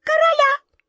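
very high tiny mouse voice; high-pitched squeaky little creature; mouse squeak. A narrator's reading. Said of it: "закончила последняя мышь".

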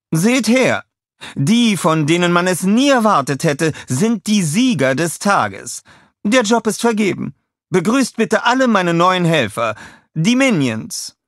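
high, loud villainess showcase; a high, loud, showy presentation; grand announcement. A narrator's reading. Scarlett proudly presents her new helpers to the crowd.

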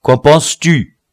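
abruptly demanding an opinion; abrupt challenge. A narrator's reading. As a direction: fire the question off without warning. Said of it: "s'adressant brusquement au citoyen général C".

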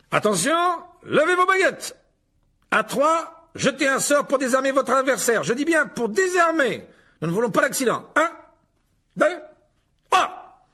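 very high, projected instructions; loud commands. A narrator's reading. Lockhart starts the pupils' duel, high and loud. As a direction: call orders across a hall.

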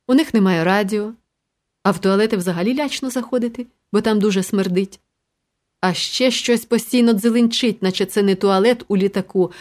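plain reading voice

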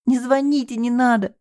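high, tearful begging; sobbing plea. Acted. Девушка рыдает, умоляя не звонить. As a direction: beg through tears not to call. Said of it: "зарыдала она".

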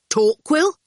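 high, loud, rising name; boy's voice. A narrator's reading. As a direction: toss the name up questioningly. Howard's lighter voice.

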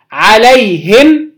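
عليهم said slowly "alayhim", i then bare m; first recited form. Shown as a demonstration. Teacher giving the first Quran reading, عليهم as "alayhim". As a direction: draw out عليهم, ending on "him". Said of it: "أدي وجه".

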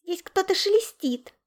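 high, loud, scared little voice; tiny panicked piping; frightened squeak. A narrator's reading. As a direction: squeak out a warning. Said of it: "пискнул Яша".

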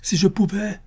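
high, anguished outburst; heartrending cry. A narrator's reading. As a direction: cry it out torn apart. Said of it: "s'écria-t-il avec un accent déchirant". (s'écrier d'une voix déchirante, aiguë)

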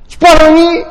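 high, eager disbelief; a giddy man who can't believe his luck; amazed Adam. Acted. Adam asking God if Eve is his, high and eager.

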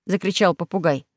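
usual speaking voice

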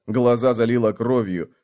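plain reading voice

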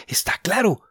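hushed whisper; whispered aside. Acted. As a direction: breathe it to yourself. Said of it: "pensó".

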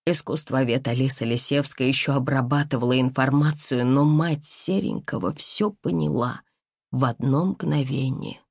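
ordinary delivery

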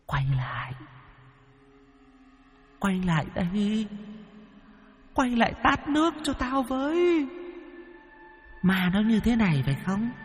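a distant eerie call carried on the wind; faint ghostly voice. A narrator's reading. The ghost calls after fleeing Lâm Sẹo, faint and distant. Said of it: "Tiếng nói cứ thoang thoảng".

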